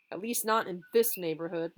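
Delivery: ordinary delivery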